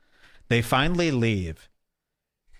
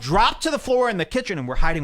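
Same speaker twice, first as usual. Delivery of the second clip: normal talking voice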